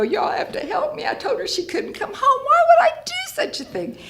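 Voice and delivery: a mother realising what she has done, wailing; panicked wail. Retold or imitated. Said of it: "and I go".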